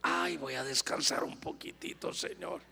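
soft, low, fading into breath; mock drowsy contentment. Complacent believer tells the Lord they will rest, drowsy fade. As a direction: sigh it out as if nodding off.

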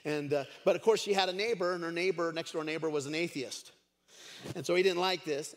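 ordinary delivery

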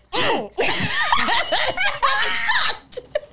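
very high loud cackle; shrieking laugh. Real, not acted. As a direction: burst out screaming with laughter. Big laugh burst.

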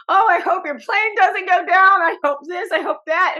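put-on high, loud little-girl voice, carefree; imitating young daughter. Mom imitates her daughter teasing grandma, high and loud. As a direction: mimic a chirpy kid fretting out loud. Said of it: "my daughter in the back is like".

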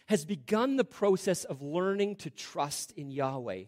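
normal talking voice

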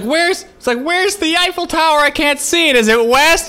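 very high, loud mock tourist shouting; embarrassingly loud. Mimicking a brother embarrassing them in public, loud and high. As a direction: blurt it out for the whole street to hear. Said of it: "out loud where the other people can hear".

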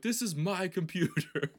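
high, loud protesting retort; hammed-up defensive yell breaking into laughter; mock indignation. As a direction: protest loudly, pretending to be outraged. Acts indignant retort to grandpa.

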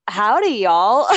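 drawn-out attempt at an American accent. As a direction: put on an American "howdy". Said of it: "that sounds American".

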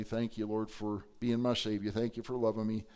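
a touch of quiet, grateful prayer; kneeling, offering thanks softly; humble thanks. Pretend.

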